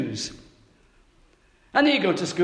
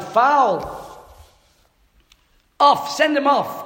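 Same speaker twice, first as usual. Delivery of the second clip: high, loud shout of protest; retold shout; 9 semitones higher, 4 dB louder, much slower than usual. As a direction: yell it like a wronged boy on the street. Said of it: "I shouted".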